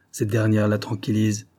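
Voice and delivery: usual speaking voice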